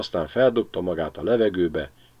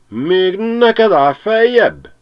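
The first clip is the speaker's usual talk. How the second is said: slow, much higher and louder outrage; outraged shout; 14 semitones higher, 10 dB louder, much slower than usual. Acted. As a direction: drag out the indignant yell. Pilátus rages at the dog. (Pilátus felháborodva kiabál a kutyára)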